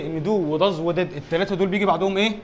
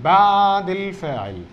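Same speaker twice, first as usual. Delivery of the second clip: بعد drawn out very long, slowly; stress on بعد; 4 dB louder, much slower than usual. Real, not acted. Teacher telling students where didn't goes, long drawn-out بعد. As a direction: stretch بعد الفاعل right out for the class.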